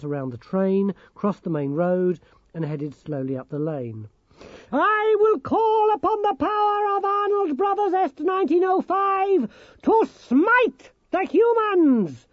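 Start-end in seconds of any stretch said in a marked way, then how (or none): 4.7-12.3 high, slow, booming gnome proclamation; shouting Nicodemus. Acted. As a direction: declaim it like a tiny zealot. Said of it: "shouted Nicodemus".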